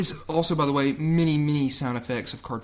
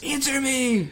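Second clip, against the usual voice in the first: high-pitched imitation yell; hammed-up, high demand for a reply; mock screaming; 11 semitones higher, slower than usual. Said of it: "Luigi screaming back".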